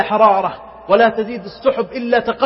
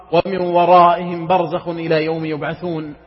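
usual speaking voice

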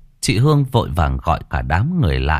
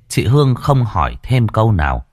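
usual speaking voice

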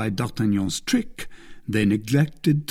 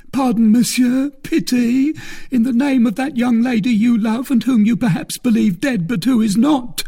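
high, loud, desperate begging; crying out; 12 semitones higher, 5 dB louder than usual. A narrator's reading. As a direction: implore your captor for pity. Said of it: "cried the bandit".

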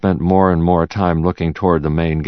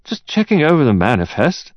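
higher-pitched attempt at nonchalance; trying to sound relaxed; 9 semitones higher than usual. A narrator's reading. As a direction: play it casual despite the unease. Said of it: "trying to sound relaxed".

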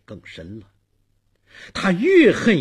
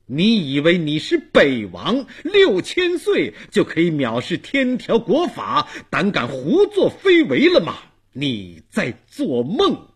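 loud, indignant rebuke building in pitch; full of anger; 5 semitones higher, 5 dB louder than usual. A narrator's reading. Dong Wang rebukes Bei Wang for flouting the law, full of anger. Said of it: "东王的声音里充满了愤怒".

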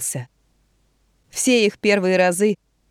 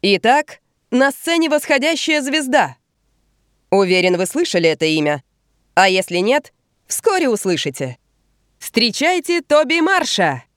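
booming prize announcer; 5 semitones higher than usual. Acted. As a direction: announce the act to the crowd. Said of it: "Ведущий объявляет".

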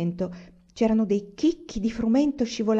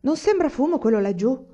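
higher, louder call across the distance; shouting; 6 semitones higher, 6 dB louder than usual. Acted. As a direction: shout to Cap far off. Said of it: "Fermò Prince e gridò". (chiamata gridata, più alta e forte)

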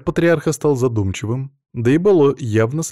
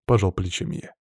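ordinary delivery, nothing marked

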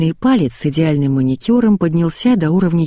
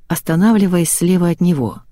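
ordinary delivery

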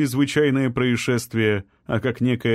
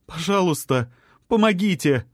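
frightened plea; 6 semitones higher than usual. A narrator's reading. Trapped woman begs.

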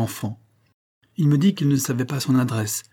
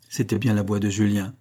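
ordinary delivery

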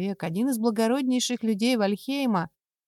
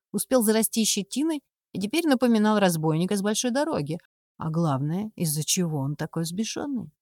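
usual speaking voice

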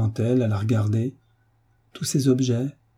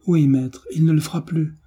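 higher, mildly tearful pleading; tearful cry; 6 semitones higher than usual. A narrator's reading. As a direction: promise it urgently, a touch of tears. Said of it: "s'écria Fédosia en pleurant à chaudes larmes".

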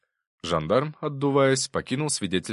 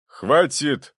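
sharp loud bark of a judge; barked stop; 9 semitones higher, 8 dB louder than usual. A narrator's reading. Judge silences the lawyers with a loud bark. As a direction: judge barks over the quarrel. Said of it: "рявкнул судья".

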